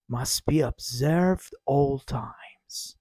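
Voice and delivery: slow whispered rule; whispered, drawn out. Shown as a demonstration. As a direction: hush it as if in the library. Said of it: "whispered, slow".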